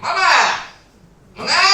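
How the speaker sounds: very high, loud call; calling wife loudly. Retold or imitated. Batak husband calling his wife from downstairs, loud yell. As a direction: yell Mama up the stairs. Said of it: "Kalau panggil istrinya gini".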